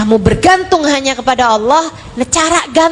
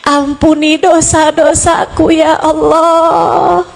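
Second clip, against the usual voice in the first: acted tearful supplication. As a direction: beg for mercy as if weeping. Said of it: "Ketika dengan linangan air mata kamu bilang".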